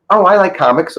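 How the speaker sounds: high-pitched imitation of Mike Tyson's voice; Mike Tyson impression. Telling the podcast host about meeting Tyson, high mock Tyson voice. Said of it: "And he goes, he goes".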